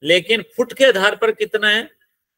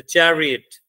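"Chariot" with weight on चै, falling away on Riot; च पर stress; slower than usual. Shown as a demonstration. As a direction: stress the opening च of "chariot". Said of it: "इसमें च हमारा stress है". ("Chariot" में पहले च पर ज़ोर)

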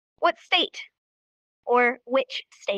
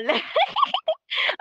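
a burst of high giggling at her own joke; high laugh. Real, not acted. Teacher laughing at her silly villain voice, giggling. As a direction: laugh it off, amused.

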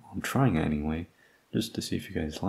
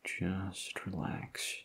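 much softer and very slow, "Just" leaned on, falling; very soft, slow hush; 10 dB quieter, much slower than usual. Acted. To the fading partner, very soft and very slow. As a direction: breathe out a near-hushed "Just relax." as if lulling them. Soothing injured partner awaiting ambulance.

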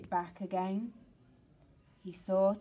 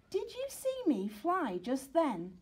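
eager pup voice; 7 semitones higher than usual. Acted. Sid eagerly asking his friend.

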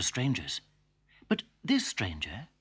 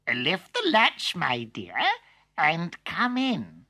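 wolf as granny; 5 semitones higher, slower than usual. Acted. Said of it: "called the wolf".

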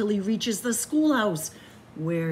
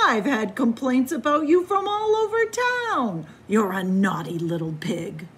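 scolding dad; 4 semitones higher, 6 dB louder than usual. Acted. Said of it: "shouts his dad".

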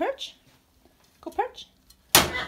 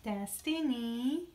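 usual speaking voice

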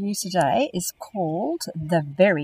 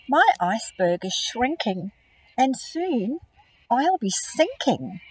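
polar bear asking brown bear for a home, smiling; 3 semitones higher, 4 dB louder, slower than usual. Acted. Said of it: "he added with a smile".

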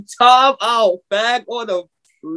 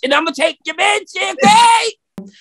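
mock shout; 7 semitones higher, slower than usual. Punchline delivered shouting.

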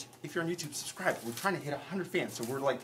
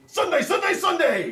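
booming monster truck ad hype; monster truck announcer; 12 semitones higher, 9 dB louder than usual. Acted. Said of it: "hype him up like a, like the monster truck announcer guy".

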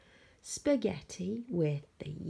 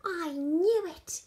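high, loud, drawn-out whoop; whoop of delight; 10 semitones higher, 8 dB louder than usual. A narrator's reading. George hears the Yeti likes spaghetti, delighted whoop. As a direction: crow it with delight. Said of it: "with a whoop of delight".

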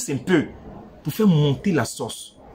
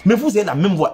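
ordinary talk

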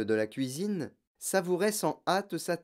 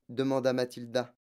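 plain reading voice

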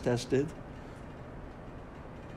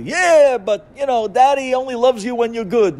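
loud, high, sneering tempter; tempter's voice; 12 semitones higher, 12 dB louder than usual. Acted. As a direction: whisper doubt like a sly tempter. Voicing the Yetzer Hara.

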